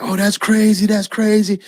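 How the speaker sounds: lightly acted, high-voiced disbelief; amazed driver. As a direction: imitate a friend marveling. Driver reacting to the event.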